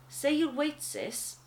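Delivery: ordinary delivery, nothing marked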